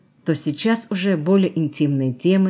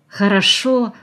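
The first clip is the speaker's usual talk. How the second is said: higher, louder delighted exclamation; 6 semitones higher, 5 dB louder, slower than usual. A narrator's reading. Valya sees her new look in the mirror, delighted. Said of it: "восторженно проговорила Валя".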